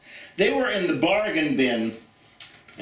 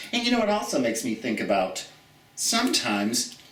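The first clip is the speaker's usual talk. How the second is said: ordinary talk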